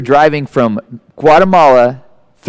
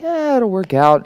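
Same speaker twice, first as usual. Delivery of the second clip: slow, soft, unbothered shrug; imitating his dad on the roadside, slow and unbothered; mock shrugging dad; 7 semitones higher, 4 dB quieter, slower than usual. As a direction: let it roll off like nothing matters. Dad shrugging off blown tires.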